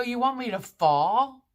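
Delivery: high, loud, alarmed disbelief; incredulous outcry. Retold or imitated. Patient to surgery staff about the gap, loud incredulous outcry. Told to scoot across gap.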